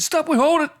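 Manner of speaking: loud, high-pitched urgent call; urgent halt. Retold or imitated. Interrupting a session for a phone call, urgent halt. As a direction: cut everyone off, high and loud.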